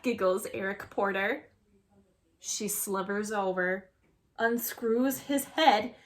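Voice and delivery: plain reading voice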